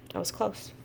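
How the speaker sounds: hushed, relieved aside. Real, not acted. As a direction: breathe out the relief softly.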